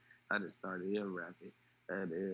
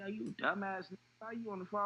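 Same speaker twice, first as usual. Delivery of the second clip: much higher, sharp scolding; retold scolding; 10 semitones higher than usual. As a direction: scolds his girl for talking on a recorded call. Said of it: "And I say to her".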